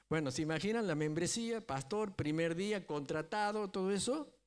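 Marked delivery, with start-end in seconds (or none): none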